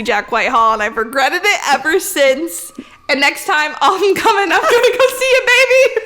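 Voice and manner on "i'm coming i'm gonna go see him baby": high, loud, laughing declaration; excited hype. Real, not acted. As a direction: whoop it out like a giddy fan. Hyped vow to see favourite comedian.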